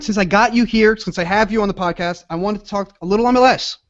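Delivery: ordinary talk, nothing marked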